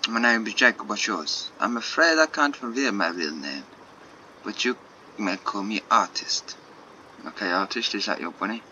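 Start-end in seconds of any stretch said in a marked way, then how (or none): 1.6-6.6 put-on French accent, measured at the end. Artist introduces themself to Jacob, French accent. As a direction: play a secretive French artist. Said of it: "so many shit French accents".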